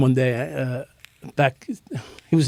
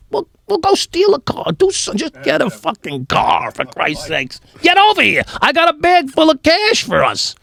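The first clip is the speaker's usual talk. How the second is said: high-pitched, incredulous barking of orders; mock yelling at a useless friend; exasperated order; 12 semitones higher than usual. Telling his buddy to go steal a car, exasperated high-pitched order.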